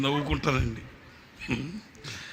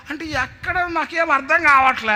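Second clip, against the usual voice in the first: higher louder whine; boy's helpless reply; 12 semitones higher, 6 dB louder than usual. Retold or imitated. Boy pleading he doesn't understand, higher louder. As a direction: plead that you don't understand. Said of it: "వాడు అంటాడు".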